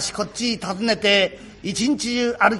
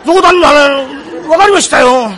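loud, exasperated protest; むきになった抗議; 4 semitones higher, 9 dB louder than usual. Acted. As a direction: blurt it out loudly, stretching the first words. 親方に脅され言い返す. (むきになって大声で抗議)

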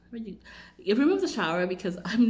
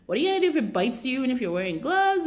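louder and fast, hurried warning; alarmed friend; 6 semitones higher, 6 dB louder, faster than usual. Retold or imitated. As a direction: friend pleads with her not to try. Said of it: "And she's like".

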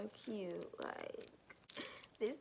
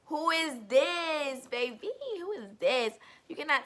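sassy hype; 6 semitones higher, 11 dB louder than usual. Real, not acted. Hyping herself in new glasses.

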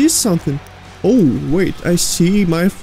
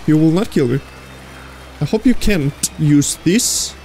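ordinary talk, nothing marked

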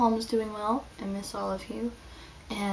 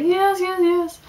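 eager yes; 10 semitones higher, 7 dB louder, slower than usual. Retold or imitated. Eager audience volunteers shout.

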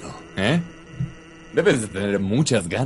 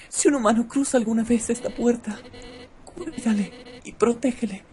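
high falsetto lady voice; man doing a woman's voice; 14 semitones higher than usual. Acted. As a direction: ask gently in a high lady voice. Quoting the woman's request.

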